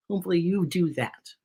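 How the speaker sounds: ordinary delivery, nothing marked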